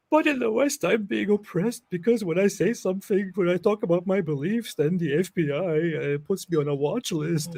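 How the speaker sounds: high, put-on complaining voice; mock aggrieved. Parodies Daniel complaining of oppression.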